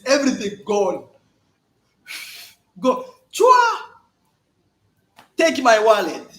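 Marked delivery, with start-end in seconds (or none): 3.3-3.8 mock kiss sound. Men lose it.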